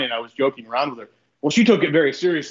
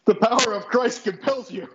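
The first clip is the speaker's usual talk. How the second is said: slow, high dramatic exorcism; exorcist voice; 8 semitones higher than usual. Acted. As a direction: intone it like a movie priest. Exorcist parody.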